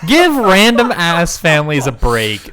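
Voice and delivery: "Give" hit hard, the rest slow and loud. Real, not acted. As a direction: drag it out loud for effect. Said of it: "venting about the film".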